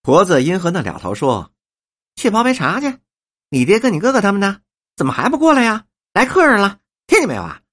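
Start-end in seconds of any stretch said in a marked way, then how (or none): 2.2-7.6 put-on high-pitched, brisk scolding to a child; bossy old woman calling orders. Old woman sends the child for tea, bossy and high. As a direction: call it out high and impatient.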